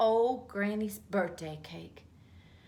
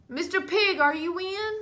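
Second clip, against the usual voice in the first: high sing-song call at the door, louder; 9 semitones higher, 3 dB louder than usual. Acted. Wolf calling to the pig inside, high and loud. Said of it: "I called".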